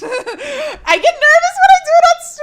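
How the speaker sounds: high, very loud laughing whine; nervous wail. Real, not acted. Streamer confessing nerves about accents on stream, nervous wail. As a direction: wail it out, half laughing, drawing out the end. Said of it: "but now I'm nervous".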